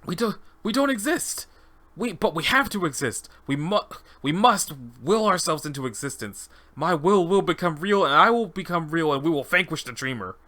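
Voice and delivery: high, halting start building into resolve; fervent test subject. Acted. Subject resolves to become real.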